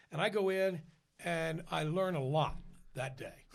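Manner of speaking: ordinary talk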